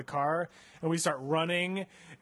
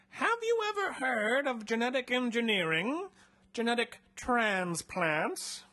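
higher put-on mad scientist voice; creepy doctor; 8 semitones higher, 3 dB louder than usual. Doctor Nair asking the captive about genetic engineering, higher sinister voice. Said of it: "Hendrik says".

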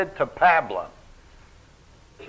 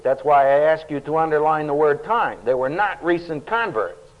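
ordinary talk, nothing marked

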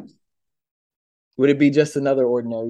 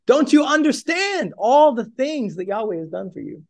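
exasperated rant; 7 semitones higher than usual. Retold or imitated. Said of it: "one part of me wants to say".

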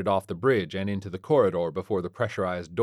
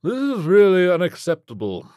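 loud, high grumble of a rich man; pompous, put-out complaint; grumbling; 10 semitones higher, 9 dB louder than usual. A narrator's reading. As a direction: grumble with wealthy indignation. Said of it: "Mister Flintlock grumbled".

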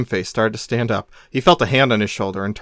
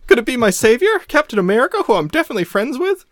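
hopeful whine; 12 semitones higher, 4 dB louder than usual. Acted. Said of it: "spark of hope".

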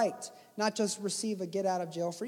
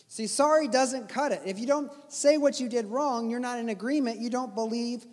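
ordinary talk, nothing marked

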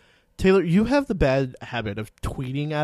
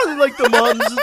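ordinary delivery

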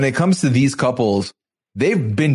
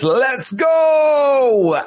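high, drawn-out hype shout; loud retold holler; 12 semitones higher than usual. Host mimics Jibri hyping up the airport, drawn-out shout. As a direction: imitate him hollering it, stretching the last word. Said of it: "And we get the loudest".

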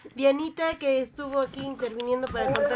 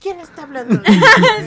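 louder, laughing disbelief; louder laughing repeat; 4 semitones higher, 11 dB louder than usual. Shown as a demonstration. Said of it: "repeated louder".

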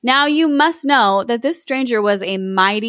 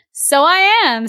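higher-pitched, cocky retort; tough street kid; 8 semitones higher, 4 dB louder, slower than usual. A narrator's reading. Aladdin answers the stranger like a street gangster, cocky. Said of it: "like, a little street gangster".

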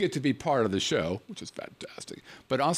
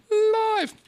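excited; 6 dB louder than usual. Acted. Drawn out high announcer call.

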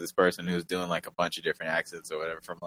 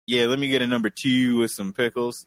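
Baltimore accent, higher and a bit livelier; Baltimore city accent; 4 semitones higher, 4 dB louder than usual. Shown as a demonstration. Demonstrating one side of the city, Baltimore accent. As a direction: order food in a Baltimore accent. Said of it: "And then on the other side".

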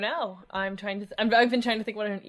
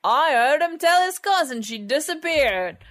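loud, high, hammy Cockney gossip; Cockney operator; 7 semitones higher, 12 dB louder than usual. Acted. Eavesdropping telephone operator gossiping later, broad mock Cockney accent. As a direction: screech it out as a nosy Cockney. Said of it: "run specifically by Cockneys".

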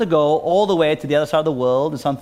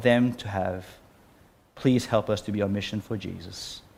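prayer; 4 semitones lower than usual. Acted. Pastor praying to God after the sermon, earnest prayer. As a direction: pray it aloud for the congregation.

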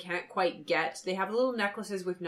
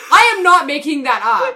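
ordinary delivery

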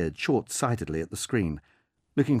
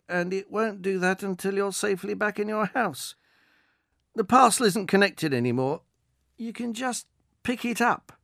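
reassuring delivery man; 10 semitones higher, 3 dB louder than usual. Acted. Delivery man reassuring the wary old woman, calm. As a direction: soothe her nerves about the drone.

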